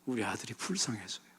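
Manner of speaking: soft, hushed, grieving reply; mother's quiet answer. Retold or imitated. Church member answers the pastor softly about her son. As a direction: barely voice the mother's heartbreak. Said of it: "했더니".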